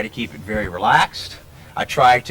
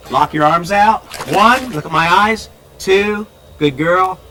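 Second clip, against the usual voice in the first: raised voice; 5 semitones higher, 6 dB louder, slower than usual. Real, not acted. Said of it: "I have to raise my voice so she can hear me".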